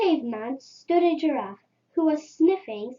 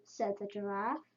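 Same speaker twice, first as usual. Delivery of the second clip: plain reading voice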